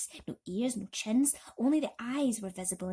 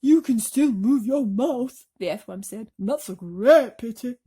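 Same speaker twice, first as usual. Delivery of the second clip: sour retort; 2 semitones higher, 12 dB louder than usual. Acted. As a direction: grumble it as a put-down. Earthworm's dry retort.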